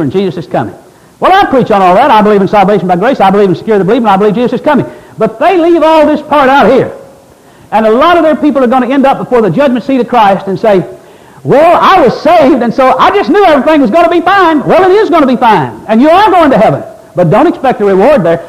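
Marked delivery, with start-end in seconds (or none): 1.2-10.8 loud, insistent pulpit delivery; urgent preaching, building in pitch; full-force preaching. Real, not acted. 11.4-14.6 high, loud, stretched-out mock pleading; hammed-up, raised-voice excuse; protesting believer. Voices complacent believers at judgment. 14.6-18.4 loud, insistent pulpit delivery; urgent preaching, building in pitch; full-force preaching. Real, not acted.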